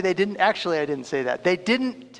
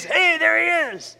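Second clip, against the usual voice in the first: high, loud mock call of an officer; retold shout; 10 semitones higher, 5 dB louder, slower than usual. Officer spotting the suspect, calling out loudly. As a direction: call out like a cop spotting his man.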